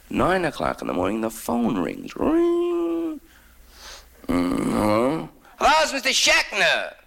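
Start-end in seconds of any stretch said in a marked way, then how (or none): 2.2-3.2 high sung phone ring; imitated phone ring. Comic imitating the phone ringing. 4.3-5.3 groggy hello. Retold or imitated. 5.6-6.9 put-on high, eager old man on the telephone. Comic voicing the old man calling him awake.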